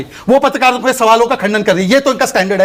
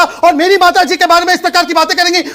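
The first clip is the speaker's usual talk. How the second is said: indignant raised voice; 6 semitones higher than usual. Real, not acted. Angry protest, anchor calms him.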